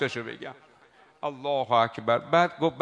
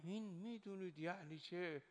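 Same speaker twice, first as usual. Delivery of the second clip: ordinary talk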